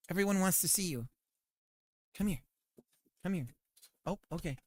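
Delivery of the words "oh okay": ordinary talk, nothing marked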